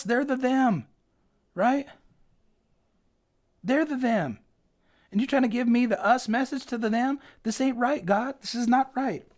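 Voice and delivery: hint of a sulky prophet arguing his case; Jonah griping. Jonah protests to God that they're the them, mildly indignant. Preacher voices Jonah's complaint.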